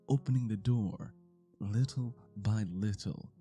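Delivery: ordinary talk, nothing marked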